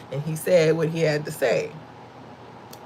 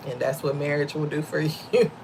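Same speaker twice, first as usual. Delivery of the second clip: laughing aside; 4 dB quieter than usual. Real, not acted. Laughing about her husband.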